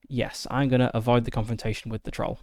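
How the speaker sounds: ordinary talk, nothing marked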